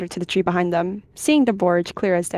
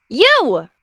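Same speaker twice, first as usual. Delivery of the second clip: high, loud yelp; angry yip; 8 semitones higher, 8 dB louder than usual. A narrator's reading. Furious she-cat confronting the flower-wrecker, yipping. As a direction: yip it sharply, pitch leaping up. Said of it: "She yips".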